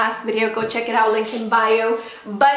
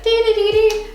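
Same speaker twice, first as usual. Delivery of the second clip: high, loud piping dee-dee; playful piped tune; bagpipe imitation; 10 semitones higher, 5 dB louder than usual. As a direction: mimic bagpipes with a high trill.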